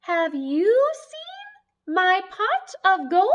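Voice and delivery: high, loud, slow leprechaun pleading; squeaky leprechaun begging for his gold. Acted. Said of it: "sound like a leprechaun".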